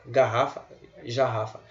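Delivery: garrafa with a hard g, then jarrafa with j; garrafa versus jarrafa. Shown as a demonstration. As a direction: contrast the hard g and the j. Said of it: "ninguém fala jarrafa". (contraste entre g duro e j)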